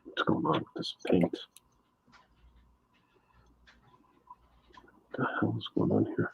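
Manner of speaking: whispered muttering to himself; whispering. Real, not acted. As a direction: murmur under your breath, half voiced. Muttering to himself while painting.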